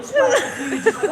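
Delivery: ordinary delivery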